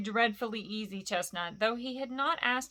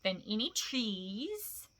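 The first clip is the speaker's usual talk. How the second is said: usual speaking voice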